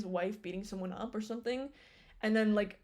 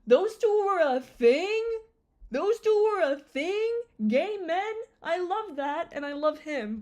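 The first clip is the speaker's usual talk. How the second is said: excited fangirl; 8 semitones higher, 6 dB louder than usual. Retold or imitated. Fan reacting to the crossover, high and delighted. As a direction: gush like you just found out. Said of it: "I'm like".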